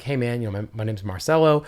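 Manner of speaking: ordinary delivery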